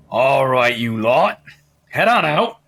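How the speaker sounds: herd them out like a tipsy bouncer; drunk Hagrid. Acted. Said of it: "How drunk is he?".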